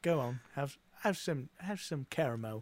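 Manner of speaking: lightly voiced British grandpa; British old man. British grandpa offering kids caramel, lightly put-on British accent. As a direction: offer it like an elderly Brit. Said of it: "I'll revert back to my British".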